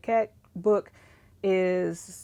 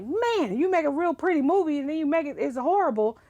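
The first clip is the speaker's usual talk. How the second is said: exasperated; 8 semitones higher than usual. Retold or imitated. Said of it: "But I'm like".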